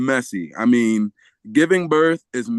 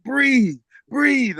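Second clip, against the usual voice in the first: high, repeated coaching cue; retold coaching call; 9 semitones higher than usual. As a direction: urge her on like a birth coach. Coaching his wife while pouring water.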